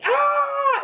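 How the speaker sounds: high-pitched imitation scream; mock scream. Husband's tale of wife pulling the cord, mock scream.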